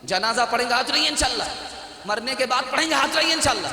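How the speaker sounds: high-pitched pledge call to a crowd; rallying call. Real, not acted. Preacher asks congregation to raise hands and pledge, rallying call.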